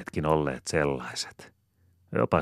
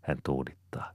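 usual speaking voice

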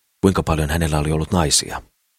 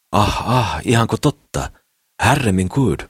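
astonished exclaiming; 5 semitones higher, slower than usual. A narrator's reading. Mimmi amazed by Sakris's boasts, exclaiming high. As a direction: gasp it out, astonished. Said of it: "ällistyi ja huudahteli".

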